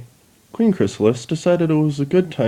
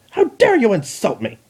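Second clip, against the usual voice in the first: high outraged cry; mock outraged scream; 11 semitones higher than usual. Chrysalis insulted by the Dalek, high mock outrage. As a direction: throw the insult back high. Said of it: "screamed Chrysalis".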